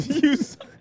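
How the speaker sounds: high taunt through laughter; laughing taunt. Pretend. Echoed taunt while laughing.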